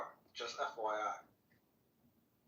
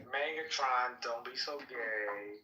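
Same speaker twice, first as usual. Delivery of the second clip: slow drawl; 3 semitones lower, much slower than usual. Acted. Drawn-out mocking.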